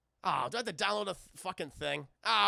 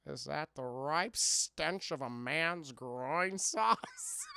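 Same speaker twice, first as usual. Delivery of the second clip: slow sleazy voice; 4 semitones lower, much slower than usual. Acted. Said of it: "a voice said".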